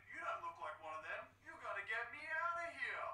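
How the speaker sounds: high, pleading captive scientist voice. Acted. Captive scientist to Snake, high and pleading. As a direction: beg the rescuer to get you out. Donald character voice.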